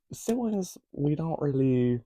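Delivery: talking around a pen; pen between the teeth; chewing on a pen. Real, not acted. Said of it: "I don't know why I'm chewing on this pen".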